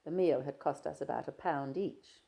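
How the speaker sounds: plain reading voice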